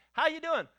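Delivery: bright, loud, high greeting; a breezy hello that hides trouble; cheery greeting. Acted. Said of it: "it tells by the tone of the voice".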